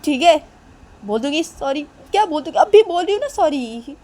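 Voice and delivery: acted impatient giving in; exasperated promise. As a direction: insist you are already doing it. Protesting agreement to apologise.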